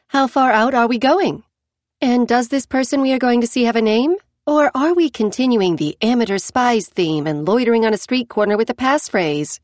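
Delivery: light, cheeky, pressing on; blithe and determined. Acted. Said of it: "blithe and determined".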